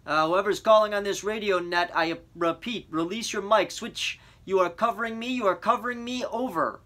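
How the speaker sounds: crisp operator over a crackling line; radio operator. A narrator's reading. Operator telling the boy to release the mic, curt radio voice.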